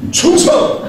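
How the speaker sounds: high, loud barked "충성!" with a laugh; mock salute. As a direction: snap a jokey military salute. Mock military salute to audience.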